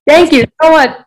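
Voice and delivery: high, loud, delighted gratitude; excited thanks. Real, not acted. Filmmaker thanking host for praising the idea, excited. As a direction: burst out with real thanks, pitched well up.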